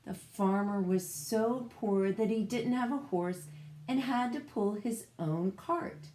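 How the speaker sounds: plain reading voice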